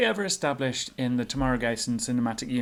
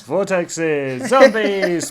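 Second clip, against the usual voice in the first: ordinary talk, nothing marked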